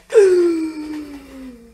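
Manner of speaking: loud dramatic gasp, high; shocked gasp. Retold or imitated. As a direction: gasp in mock horror. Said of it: "everyone was like".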